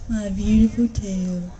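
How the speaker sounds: dreamy murmur. A narrator's reading. Possum dreaming of his tail.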